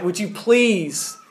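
slow, soft plea, drawn out; re-enacted earnest, hushed appeal; pleading. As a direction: plead gently, stretching the words. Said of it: "I plead with people".

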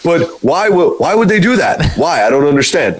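high, pleading and baffled; whiny confused friend. Retold or imitated. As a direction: whine it out in confusion. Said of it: "You'd be like".

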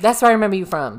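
excited. Real, not acted. Excited recognition.

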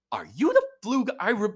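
high, loud, laughing clerk; excited recognition. Retold or imitated. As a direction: gush like a starstruck fan. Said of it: "She goes".

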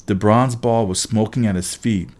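ordinary delivery

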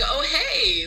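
high-pitched, over-friendly hello; mock friendly greeting. Greeting a family she might not see again, mock friendly. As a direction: imitate an eager greeting to a near stranger.

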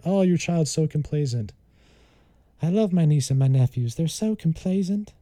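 doting Southern aunt; Southern accent. Acted. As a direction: put on a Southern accent, praising the children. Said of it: "like, a Southern accent there".

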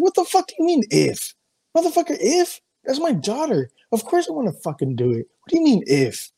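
very high-pitched, incredulous outburst; pissed-off outrage. Retold or imitated. Father retelling his reply when offered tests for his daughter, outraged. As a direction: squeal it in retold fury, pitch leaping up. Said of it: "it just pissed me off".

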